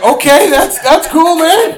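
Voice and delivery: high, loud startled okay; awkward surprise. Retold or imitated. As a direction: cover shock with a forced cool. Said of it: "I was like".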